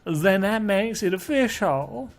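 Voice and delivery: put-on sweet anime girl voice. Voices the club president.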